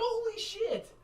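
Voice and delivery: high, loud, laughing disbelief; shocked exclamation. Retold or imitated. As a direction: blurt it out in shock. Shocked reaction quote.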